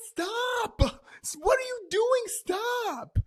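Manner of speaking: very high, loud screechy plea; imitated yelling. Friend yelling at the storyteller to stop jumping, screeched. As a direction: yell it high like a panicked friend. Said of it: "her yelling also was doing something".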